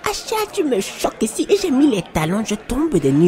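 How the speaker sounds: high, outraged exclamation; scandalised high-pitched protest; shocked. Acted. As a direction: reel from the shock. Friend shocked by revelation.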